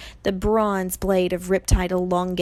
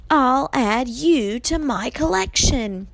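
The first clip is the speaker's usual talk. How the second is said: raised, slow, menacing snarl; snarled threat; 6 semitones higher, 4 dB louder, slower than usual. A narrator's reading. Medusa threatens Grover, snarling slowly. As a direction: draw out the angry threat. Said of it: "she snarled".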